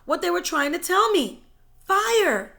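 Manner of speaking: loud, eager that's it; excited realization. A narrator's reading. Brian realizing the answer, loud and excited. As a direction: burst out with the discovery.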